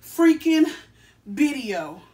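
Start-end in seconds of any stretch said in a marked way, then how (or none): 0.0-0.6 freaking punched higher and louder; stress on freaking. Acted. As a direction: hit the word freaking hard. Hyped intro emphasis.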